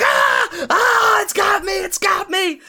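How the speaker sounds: high-pitched mock scream of terror; comic damsel-in-distress screaming; panicked screaming. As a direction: shriek in hammed-up panic as if under attack. Acting woman attacked by rat.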